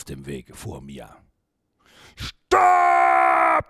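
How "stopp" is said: long, low, booming yell; shouted. A narrator's reading. Porcupine blocks Mia's path, shouting. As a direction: bellow it deep and drawn out. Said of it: "schrie es".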